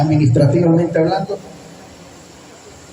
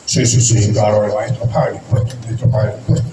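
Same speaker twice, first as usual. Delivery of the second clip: low, hurried yes-yes-yes of a cornered disciple; flustered Peter; 8 semitones lower than usual. Retold or imitated. Peter to the lawmen, caught off guard: hurried low reassurance. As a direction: Peter blurts it out lower, eager to end the question.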